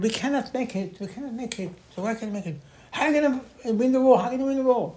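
high-pitched retold objection of the spies; worked-up imitation of doubters; agitated protest. Acting out the frightened spies' protest.

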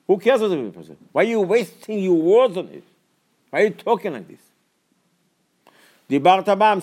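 high, loud rebuke to the congregation; preacher scolding his flock; fiery preaching. Acted. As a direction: hammer the point home from the pulpit. Preacher builds to fiery exhortation.

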